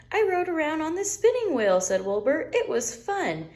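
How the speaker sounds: high, gleeful lamb voice; high excited Wilbur; delighted little lamb. Acted. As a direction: beam with pride, way up high.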